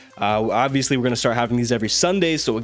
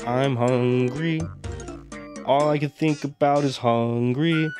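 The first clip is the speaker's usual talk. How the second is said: slow groggy whine; slower than usual. Real, not acted. Blooper, slow distant whine.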